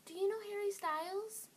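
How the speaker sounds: high, loud starstruck question; excited girl imitation. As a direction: imitate a thrilled fan asking, high-pitched and loud. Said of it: "Favorite one that they've said".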